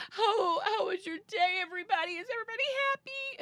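high, fast, sing-song cheeriness; forced cheer. Retold or imitated. Mother walking in the door to her kids, forced bright cheer. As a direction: cover the tears with fake brightness. Forcing cheer after crying.

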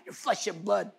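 usual speaking voice

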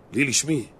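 ordinary talk

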